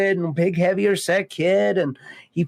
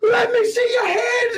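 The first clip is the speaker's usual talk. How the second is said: mock police shout. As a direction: yell it like a cop at a bust. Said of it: "He's like".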